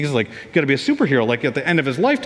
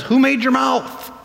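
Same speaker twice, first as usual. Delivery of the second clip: higher, slow, pointed question; God challenging; 9 semitones higher, slower than usual. Retold or imitated. God answering Moses' excuse, slow pointed challenge. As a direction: press the question home. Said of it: "And God says".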